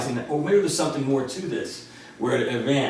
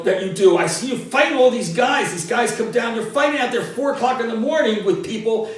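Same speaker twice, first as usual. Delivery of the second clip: higher, louder, exasperated father telling his son off; angry dad scolding; 8 semitones higher, 5 dB louder than usual. Retold or imitated. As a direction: give it the fed-up dad yelling about late-night fights. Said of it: "He goes".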